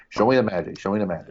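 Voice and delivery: lightly imitated Brooklyn accent doing Shakespeare; hint of Brooklyn in a Shakespearean line. Podcaster mimicking a film actor's Shakespeare, lightly put-on Brooklyn accent. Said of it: "a Brookman accent doing Shakespeare-y kinda".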